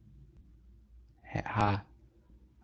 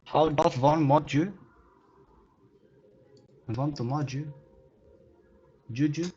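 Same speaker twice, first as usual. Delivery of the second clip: higher pleading; 6 semitones higher than usual. Real, not acted.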